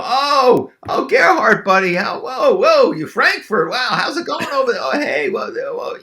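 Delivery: high, loud gush of hammed-up delight; amused, delighted reaction. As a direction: imitate a tickled target greeting an old buddy. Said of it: "and they're, and they're laughing".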